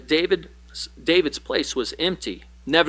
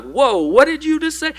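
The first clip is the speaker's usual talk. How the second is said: high, loud startled "whoa"; jolted in disbelief at the insult; shocked disbelief; 11 semitones higher, 5 dB louder than usual. Retold or imitated. Shocked reaction to harsh verse.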